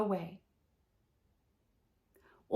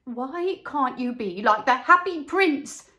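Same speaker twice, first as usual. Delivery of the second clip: mild scolding, a bit higher; scolding mother; 4 semitones higher, 6 dB louder than usual. Acted. Sensible mother scolding her crying little boy. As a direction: chide a crying child lightly. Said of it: "asked a sensible mother".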